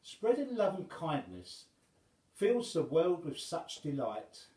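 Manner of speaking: ordinary delivery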